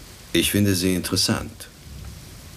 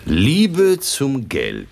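mock fortune teller; 8 semitones higher, 4 dB louder, much slower than usual. Reading palm theatrically.

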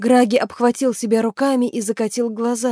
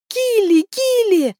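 high, urgent calling; desperate summons; 13 semitones higher, 3 dB louder, slower than usual. A narrator's reading. Maeve remembering the name and summoning help, high call.

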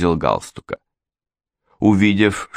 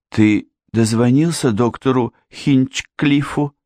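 high, slow worried question; anxious daughter; 7 semitones higher than usual. A narrator's reading. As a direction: ask anxiously in a raised girl's pitch. Anxious daughter questions her father. (высокий встревоженный голос дочери)

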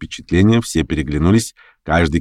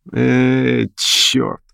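higher-pitched, drawn-out mild curse; cursing; 6 semitones higher than usual. A narrator's reading. Pianist giving in to the hostess, cursing mildly. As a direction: curse in annoyance, without volume. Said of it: "выругался Лямшин".

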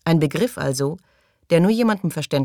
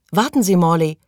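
louder call from behind; 3 semitones higher, 6 dB louder than usual. A narrator's reading. Maggie calls after Morley at the door, loud. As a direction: call after him to stop him. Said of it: "rief Maggie hinter ihm".

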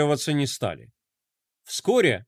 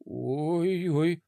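soft, worried little whisper; anxious whisper; 6 dB quieter than usual. A narrator's reading. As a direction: breathe it out nervously. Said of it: "тревожно прошептал Флай".

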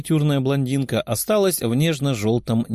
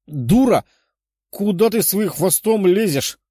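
high, louder indignant shout; angry cry; 6 semitones higher, 3 dB louder than usual. A narrator's reading. Princess cries out at the dragon's prying tail, angry. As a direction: cry out, outraged at the intrusion. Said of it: "выкрикнула она".

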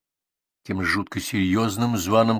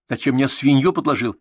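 louder, higher indignant accusation; 5 semitones higher, 6 dB louder than usual. A narrator's reading. Boy accusing his friend, indignant and loud. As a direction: throw the betrayal back at him.

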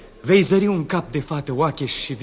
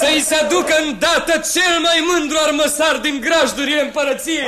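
poruncă veselă; 10 semitones higher, 3 dB louder than usual. Acted.

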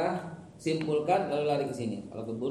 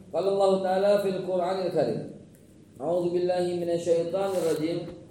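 sermon opening; 3 semitones higher, 7 dB louder than usual. Acted. Preacher greets the congregation, loud formal recitation. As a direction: proclaim the Arabic opening to the hall.

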